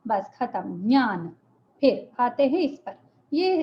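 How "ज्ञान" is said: ज्ञान as a short "nyaan"; correct nasal form. Shown as a demonstration. Teacher sums up the rule, ज्ञान as "nyaan". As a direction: start ज्ञान directly on "ny", j unheard. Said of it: "ज बोलने की कोशिश होगी".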